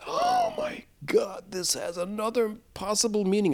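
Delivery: high, soft startled gasp of discovery; sudden realization. Retold or imitated. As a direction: jumps way up in pitch, struck by the thought. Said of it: "I suddenly realized".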